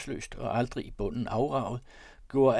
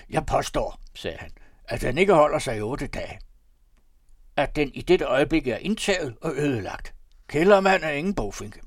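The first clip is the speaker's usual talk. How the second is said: heated debate; 4 semitones higher, 8 dB louder than usual. A narrator's reading. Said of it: "en heftig debat".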